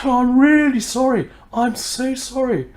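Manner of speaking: high, flustered apology; gushing apology. Retold or imitated. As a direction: apologise in a rush of embarrassment. Apologising after seeing the price.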